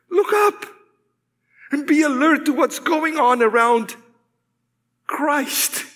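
high, lifted scripture call; rousing exhortation. Acted. Preacher reading Colossians to rouse the listeners, urgent. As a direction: lift the voice high and urge them on.

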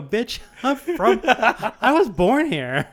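high, loud and slow, half laughing; laughing comeback. Retold or imitated. Driver snapping back at the drunk woman, loud, high and laughing. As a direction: fire back, cracking up as you say it. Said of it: "I go".

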